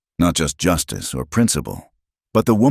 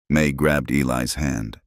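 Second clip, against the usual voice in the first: plain reading voice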